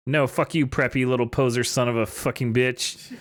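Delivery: ordinary talk, nothing marked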